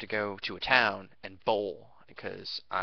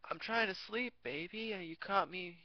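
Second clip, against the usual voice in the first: higher, softer fake sleepy voice; caught liar; 9 semitones higher, 7 dB quieter than usual. Boyfriend pretending to his girlfriend he was asleep, soft and high. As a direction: fake being half asleep to cover up. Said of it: "She calls him a few minutes later".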